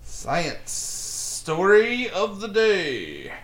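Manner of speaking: slow, drawn-out announcer voice; segment announcer. Acted. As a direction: present it like a big reveal.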